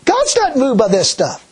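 ordinary talk

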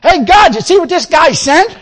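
high and loud, waving the letter at God; excited call to God. Retold or imitated. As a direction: call out to God, all worked up. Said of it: "He gets out and says".

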